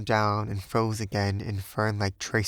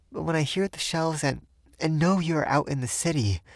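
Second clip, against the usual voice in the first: higher, anxious plea; fearful pleading; 7 semitones higher than usual. A narrator's reading. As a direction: let the fear show. Sylvia fearful for Jack.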